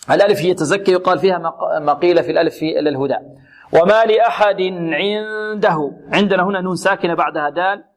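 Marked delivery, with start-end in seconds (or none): 3.7-5.9 slow verse, n of عنده hidden in a long hum; ikhfaa recitation. Shown as a demonstration. Teacher reciting to show the rule, long hidden noon. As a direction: stretch a nasal sound before the د in عنده. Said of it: "حكمها الإخفاء".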